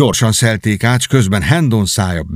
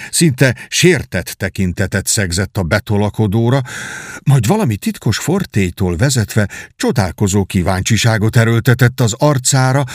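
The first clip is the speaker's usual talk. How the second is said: ordinary delivery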